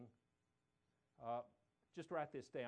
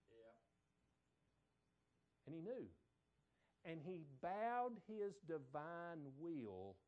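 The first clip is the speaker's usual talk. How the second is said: ordinary talk, nothing marked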